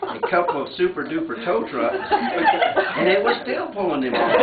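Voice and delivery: reading while laughing; laughing through reading. Real, not acted. Reading the fish tale aloud, laughing at it. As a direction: laughs break into the words. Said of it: "laughing through tall tale".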